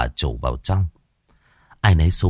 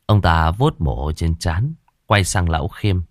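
ordinary delivery

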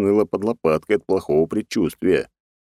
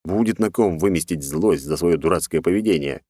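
ordinary delivery, nothing marked

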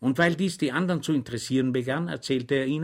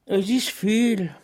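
high, slow, wide-eyed reply; innocent; 9 semitones higher, 5 dB louder, much slower than usual. A narrator's reading. Schweig answering the official, high, slow and innocent. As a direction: say it with childlike innocence. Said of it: "erwiderte Schweig unschuldig".

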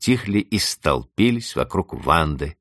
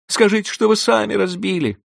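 put-on high, louder childish plea; pleading girl; 4 dB louder than usual. Vanda begging the housekeeper, high pleading. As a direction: beg for rescue.

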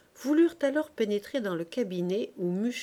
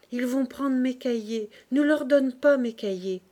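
ordinary delivery, nothing marked

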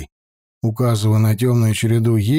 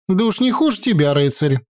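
higher, bold peasant woman; sharp village woman retort; 7 semitones higher than usual. A narrator's reading. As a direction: snap back at the knight.

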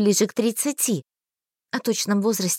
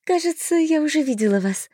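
high, sweet fairy voice; a curious little fairy; 6 semitones higher than usual. A narrator's reading. Fairy squints.

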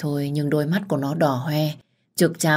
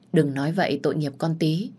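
usual speaking voice

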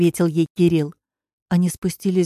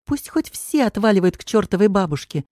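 usual speaking voice

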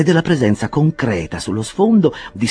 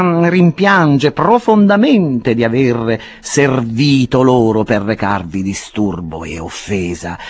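higher, slow, coaxing lawyer voice; lawyer Perker, persuasive; 6 semitones higher, 5 dB louder than usual. Acted. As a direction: talk him round with solemn persuasion. Perker argues with Pickwick.

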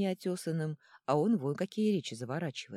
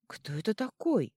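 higher-pitched indignant question; 6 semitones higher, 4 dB louder than usual. A narrator's reading. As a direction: demand an answer, mildly outraged. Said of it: "возмутился Ёжевиш". (возмущённо)

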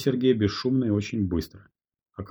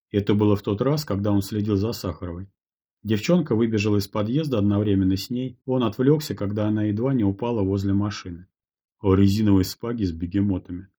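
ordinary delivery, nothing marked

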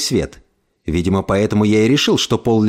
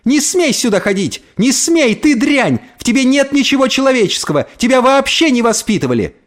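high-pitched, outraged tirade; piercing angry shouting; 11 semitones higher than usual. A narrator's reading. Mother forbids the girl to come back, piercing angry shouting. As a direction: scold in a piercing, frantic voice. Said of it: "крикнула она не своим голосом".

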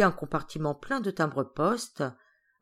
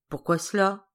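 mildly surprised question; astonished; 3 dB louder than usual. Acted. Geneviève hearing she is left behind, mildly astonished. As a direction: ask it with a touch of astonishment. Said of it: "Geneviève étonnée".

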